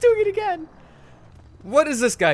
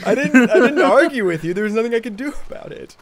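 usual speaking voice

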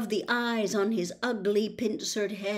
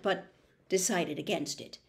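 usual speaking voice

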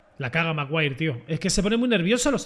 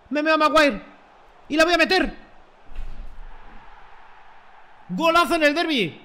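excited cheering; 7 semitones higher than usual. Real, not acted. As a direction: shout it out as the ball goes in. Excited celebrating a derby goal. (grito de gol emocionado, agudo y fuerte)

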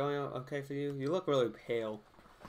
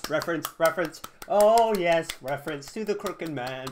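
much higher, loud excited yelling; excited shout; 5 semitones higher, 12 dB louder than usual. Real, not acted. Player spots a callback, shouting excitedly. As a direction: burst out thrilled at the callback. Spots the crooked man reference.

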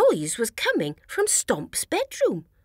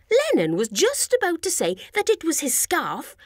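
ordinary delivery

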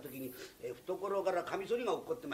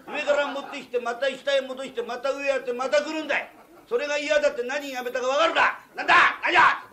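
loud, higher, rising to a yell; escalating quarrel; 5 semitones higher, 10 dB louder than usual. Acted. Craftsman and master quarrel, rising to a full shout. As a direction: build the fight to a shouting peak.

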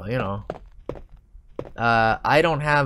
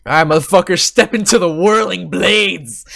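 loud taunt; 5 semitones higher, 8 dB louder than usual. Pretend. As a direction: taunt him like an action hero. Taunting zombie in game.

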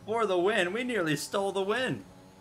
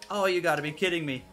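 ordinary talk, nothing marked

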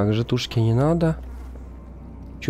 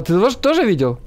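normal talking voice

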